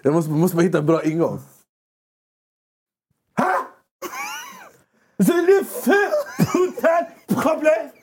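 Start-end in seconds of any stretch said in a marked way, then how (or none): 3.4-7.9 high, shouted mock French rant; hammed-up furious Frenchman; fake angry French. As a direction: yell in pretend French like an angry Parisian. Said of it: "genom att låta arg".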